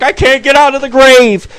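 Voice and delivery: high-pitched panicked wail, hammed-up; mock crying. Retelling his buddy stuck in the grave, mock crying. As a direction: imitate a buddy wailing for help. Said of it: "He's crying".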